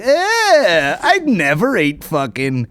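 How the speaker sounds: high sneering voice; mock snob. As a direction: sneer like a picky eater. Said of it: "and I'd be like".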